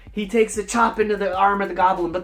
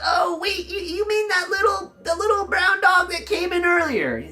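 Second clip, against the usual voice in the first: high, pleading goblin voice; a cringing goblin eager to help; whiny goblin; 11 semitones higher than usual. Acted. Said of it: "the goblin says".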